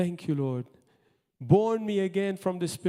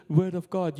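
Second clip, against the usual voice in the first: ordinary delivery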